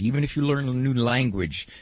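usual speaking voice

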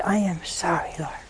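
unvoiced, intimate whisper to God; whispered apology. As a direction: whisper the prayer humbly. Quiet prayer of apology.

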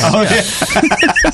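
laughing. Real, not acted. Laughing agreement.

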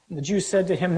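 ordinary talk, nothing marked

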